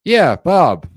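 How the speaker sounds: high, loud casual greeting; easy familiar hello. Shown as a demonstration. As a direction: sound like an old friend calling. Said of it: "We wanna sound like this".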